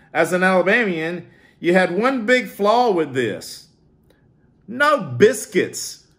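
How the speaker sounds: a hint of a fussy critic dragging out the verdict; mock commenter.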